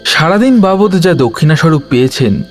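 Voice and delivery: ordinary talk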